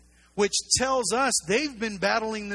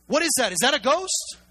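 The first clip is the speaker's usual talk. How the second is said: higher, louder alarmed questions; freaked-out disciples; 5 semitones higher, 5 dB louder than usual. Acted. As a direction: act startled at a strange sight. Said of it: "Their reaction is".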